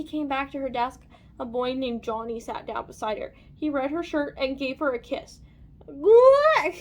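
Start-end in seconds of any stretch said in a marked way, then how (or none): 5.9-6.7 high, loud yelp; disgusted yell. A narrator's reading. Lacey reacts to being kissed by a boy, yelled. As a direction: yell out a big yuck. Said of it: "yelled Lacey".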